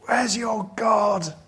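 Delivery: high, slow taunting call; mocking taunt. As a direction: jeer at the prophets of Baal. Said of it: "What a contrast between Elijah in chapter eighteen".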